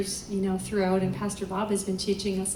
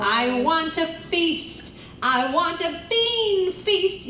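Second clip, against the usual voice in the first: put-on slow, higher, louder spoiled-girl demand; bratty Veruca; 7 semitones higher, 4 dB louder, slower than usual. Teacher imitating spoiled Veruca to show twang, bratty. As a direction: whine it like a spoiled brat on stage. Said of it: "I'm picturing Veruca".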